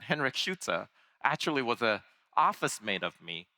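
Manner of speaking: ordinary talk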